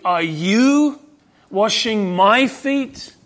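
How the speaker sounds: "are you" rising, then "washing my feet" slow with "my" leaned on hard; slow and incredulous, "my" stressed; emphasis on my. Retold or imitated. Peter shocked that his Lord would wash his feet, "my" heavily stressed. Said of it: "there's an emphasis here in the Greek".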